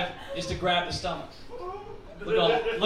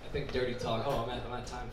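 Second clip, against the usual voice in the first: usual speaking voice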